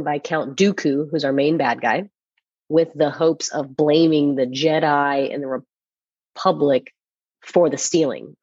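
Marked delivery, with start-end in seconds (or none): none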